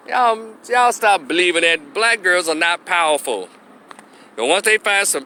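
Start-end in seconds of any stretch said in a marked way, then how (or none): none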